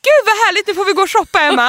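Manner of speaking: imitating a thrilled mother-in-law, high and loud; gushing delight. Mother-in-law proposing shopping to Emma, gushing. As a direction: squeal it with over-the-top delight.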